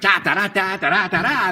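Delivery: a chirpy upbeat jingle sung as nonsense; bright bouncy vocalising. Shown as a demonstration. Voice actor demonstrating an upbeat read that doesn't fit this character. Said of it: "anything bright".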